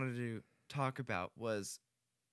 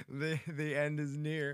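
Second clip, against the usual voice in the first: mock doom; 4 semitones higher, 4 dB louder, slower than usual. Joking doom after laugh.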